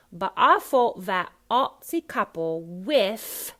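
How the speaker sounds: loud, slow Cockney, "with" ending in f; WITH said as wiff, FOUGHT without t. Shown as a demonstration. Coach demonstrating the line, Cockney th-as-f. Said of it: "T H at the end is an F".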